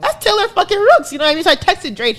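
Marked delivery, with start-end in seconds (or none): 0.0-1.1 starstruck realization. Retold or imitated. Recognizing a celebrity in line.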